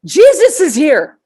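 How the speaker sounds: excited friend yelling the news; retold scream. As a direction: shout it slowly as an excited all-caps text.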